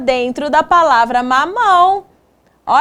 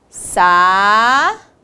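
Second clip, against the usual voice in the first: each syllable drawn out, long pauses between; syllable-by-syllable dictation; 5 semitones lower, much slower than usual. Shown as a demonstration. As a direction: dictate slowly, one held syllable at a time. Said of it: "separamos essas palavras em sílabas".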